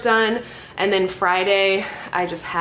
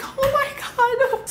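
very high, slow, laughing oh my God; shocked gasp; much slower than usual. Retold or imitated. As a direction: stretch it out in laughing panic. Panic at swollen ear.

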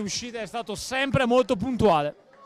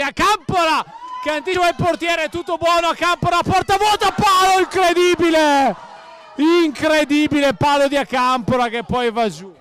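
high, loud, fast excited shout; breathless italian goal commentary; 5 semitones higher, 6 dB louder than usual. Real, not acted. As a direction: yell it as the ball hits the post. Near goal, hits the post.

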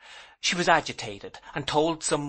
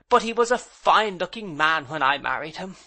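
hint of old pride; 6 semitones higher than usual. A narrator's reading. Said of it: "echo of her old pride and admiration".

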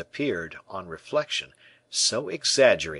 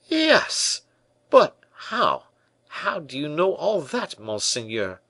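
much higher, astonished outburst; a tailor floored that his secret is known; astounded tailor; 8 semitones higher, 4 dB louder, slower than usual. A narrator's reading. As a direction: voice leaping up in disbelief. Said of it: "said Percerin, astounded".

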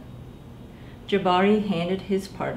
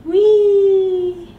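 put-on much higher and louder, a child's gleeful "Whee!"; excited squeal; 13 semitones higher, 12 dB louder than usual. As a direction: squeal with pure delight as it flies. Child flies rocket, squeals.

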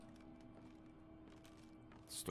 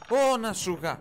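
sudden loud high cry of fright; real startled outburst; startled yell; 14 semitones higher, 11 dB louder, slower than usual. Real, not acted. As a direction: jolt and yell at the scare. Jump scare in horror game.

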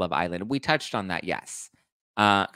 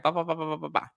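rapid higher-pitched "bah" babble; mimed runaway mouth; 7 semitones higher, faster than usual. Retold or imitated. Explaining her mouth gets her in trouble, mock babbling. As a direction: babble like a mouth that won't stop. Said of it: "My m-, mouth is just like".

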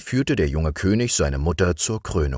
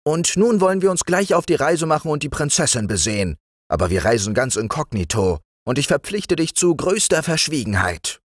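put-on raised, higher young king; king appointing his friend the frog chamberlain, higher voice; young king's voice; 11 semitones higher than usual. A narrator's reading. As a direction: play the young king granting an honour. King's dialogue.

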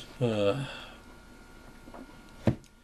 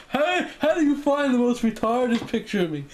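indignant; 10 semitones higher than usual. Retold or imitated. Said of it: "me going, like".

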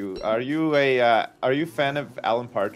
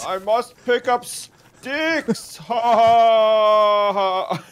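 very slow, high devil voice, laughing; hammed-up evil lord; dark lord; 6 semitones higher, 3 dB louder, much slower than usual. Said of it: "bad at R Ping the devil".

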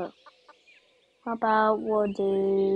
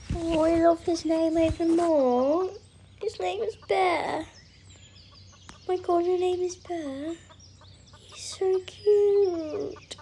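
higher, slow doting coo; cooing at the horse; 6 semitones higher, slower than usual. Real, not acted. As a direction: sweet-talk the new horse gently. Cooing to the horse named Bear.

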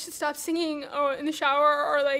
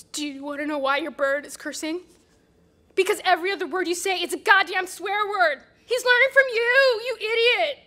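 mean insult; 5 dB louder than usual. Acted. Annie insulting the bird owner, loud and mean. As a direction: spit the insult at him. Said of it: "that was so mean".

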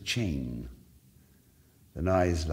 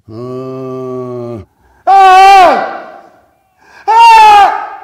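screams building much higher and louder; three shrieks, each far higher; shrill screams; 8 semitones higher, 22 dB louder, much slower than usual. A narrator's reading. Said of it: "loud and shrill screams".

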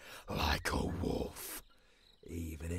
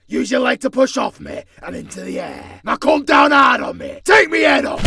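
shouted coaching; 21 dB louder, faster than usual. Acted. Trainer yelling during sparring.

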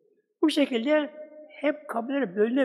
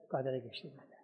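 much softer, lower repeat; hushed echo; 6 semitones lower, 12 dB quieter, faster than usual. A narrator's reading. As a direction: murmur it back as an afterthought. Soft echo of previous phrase. (çok alçak sesle, yankı gibi tekrar)

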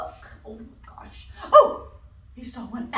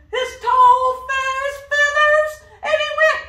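loud, high sing-song buildup; excited build-up; 15 dB louder than usual. Acted. Building to trumpet.

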